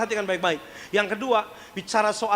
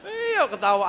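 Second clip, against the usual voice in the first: ordinary delivery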